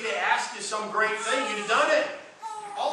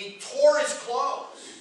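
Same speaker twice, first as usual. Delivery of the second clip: slow emphasis; 7 semitones higher, 5 dB louder, much slower than usual. Acted. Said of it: "preacher dramatizes".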